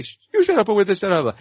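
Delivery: quick, high mock Italian accent; imitated Italian yell. Another neighbor joining the shouting match, mock Italian yell. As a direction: fire back as a cartoon Italian neighbor. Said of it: "and then another guy".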